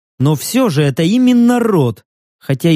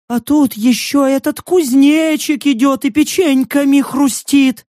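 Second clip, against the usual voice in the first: high, whining excuses; panicky pleading; 8 semitones higher than usual. A narrator's reading. Stranger excuses himself to armed group, panicky. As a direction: plead and explain in a high, agitated voice.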